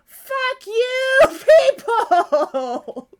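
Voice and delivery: high-pitched yell drawn out at the end; outraged cursing. Real, not acted. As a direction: curse them out in loud outrage. Cursing the show's villains.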